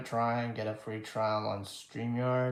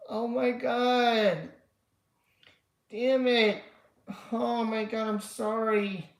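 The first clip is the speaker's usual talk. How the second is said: dismayed exclaiming; 8 semitones higher, slower than usual. Real, not acted. Viewer missed the stream.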